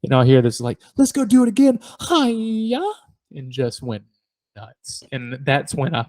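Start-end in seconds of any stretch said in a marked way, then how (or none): 1.0-2.8 high-pitched, excited kid imitation; imitating excited nephew. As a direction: pitch it way up, end on a long squeal. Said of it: "he got really excited".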